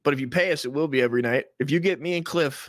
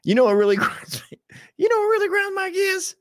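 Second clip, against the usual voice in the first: very high, louder mock voice; Family Guy bit; 4 dB louder than usual. Doing a Family Guy style rant, high and loud. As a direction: belt it out like a cartoon rant segment. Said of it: "Family Guy segment".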